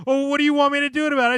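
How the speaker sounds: high, loud, rushed mimicry; exasperated retort. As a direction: fire it back as a mock retort, fast. Retelling co-host's exasperated retort.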